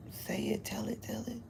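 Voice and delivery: whispered coaxing; urging whisper. Retold or imitated. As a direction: egg her on in a whisper. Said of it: "Yehuda kept saying".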